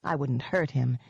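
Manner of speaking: usual speaking voice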